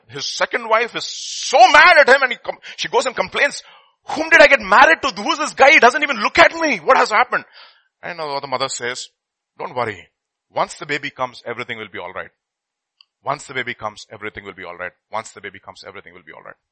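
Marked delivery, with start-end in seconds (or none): 4.0-7.4 high, loud indignant wife; second wife griping about her husband, high and loud; angry complaint. Acted. 9.6-16.6 soothing mother. Acted. Mother soothing, repeating fading.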